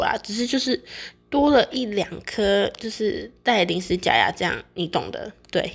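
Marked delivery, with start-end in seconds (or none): none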